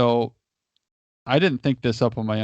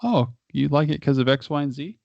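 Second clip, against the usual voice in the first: nice, unsarcastic question; 3 semitones higher than usual. Shown as a demonstration. Coach modelling a non-confrontational reply to a colleague, nice question. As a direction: ask it nicely, without sarcasm or confrontation. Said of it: "As a, as a nice question. You're not being sarcastic".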